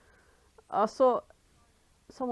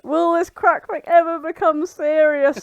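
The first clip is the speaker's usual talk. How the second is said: mock trailer voice; 7 semitones higher, 11 dB louder than usual. Dramatic trailer-style summary reading.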